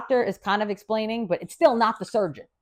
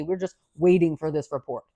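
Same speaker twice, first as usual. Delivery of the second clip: usual speaking voice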